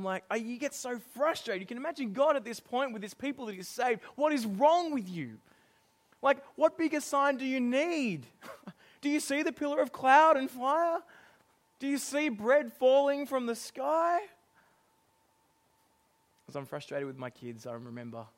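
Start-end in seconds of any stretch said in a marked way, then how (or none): 4.1-14.2 exasperated God. Retold or imitated. God frustrated with his people.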